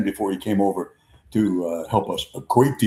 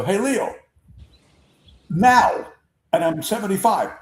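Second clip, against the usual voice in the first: put-on high teasing old-timer's boast; Jerry Coleman teasing; 10 semitones higher than usual. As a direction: rib him with a high playful challenge. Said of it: "Only Jerry would call him Leo".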